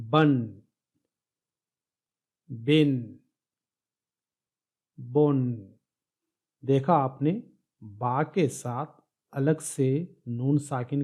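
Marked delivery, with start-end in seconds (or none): 0.1-5.6 بن with a clear added n at the end; teacher sounding out بن with the nun sound; nunation drill. Shown as a demonstration. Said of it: "پھر سے دیکھیے". (تنوین کے ساتھ بن، بن، بن واضح نون کی آواز)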